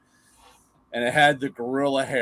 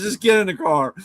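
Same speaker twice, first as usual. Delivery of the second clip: louder, higher snap of a fed-up dad; impatient dad order; 8 semitones higher, 6 dB louder than usual. Retold or imitated. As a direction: bark it at the kid, fed up. Dad ordering again, quoted.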